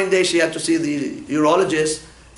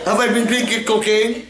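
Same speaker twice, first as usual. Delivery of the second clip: laughing; 5 semitones higher than usual. Real, not acted. Joking about Coke habit.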